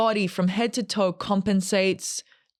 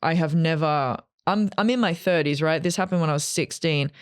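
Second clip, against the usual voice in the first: ordinary talk, nothing marked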